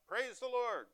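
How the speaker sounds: high, ringing cry of praise; joyful shout. Retold or imitated. Old lady on her porch praising God, high joyful shout. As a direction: call it up to the sky. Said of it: "raise her arms to the sky and shout".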